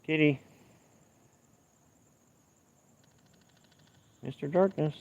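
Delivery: high, sing-song call; calling the cat. Real, not acted. Owner calling his cat Darkness, high sing-song call.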